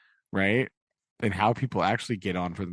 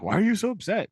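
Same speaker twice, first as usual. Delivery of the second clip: pitched up high and puzzled; mock puzzled question; 12 semitones higher, 3 dB louder, faster than usual. Pip asking Biddy why she's upset, high and puzzled. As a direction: play Pip, baffled and a bit whiny.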